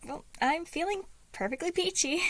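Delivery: best dad voice. A narrator's reading. Patton hiding his sadness from Logan, forced cheery dad voice. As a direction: fake it with a big sunny dad voice. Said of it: "he answered in his best dad voice".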